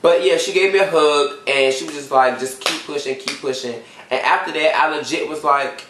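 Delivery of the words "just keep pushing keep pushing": soft, soothing reassurance; retold gentle encouragement. Lady encouraging the student after a hug, gentle.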